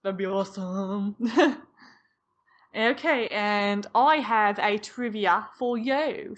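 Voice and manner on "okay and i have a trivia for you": slow, put-on British accent; a hint of a bad British accent. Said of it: "All right, British woman".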